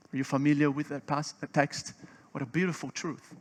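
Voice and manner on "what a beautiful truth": ordinary talk, nothing marked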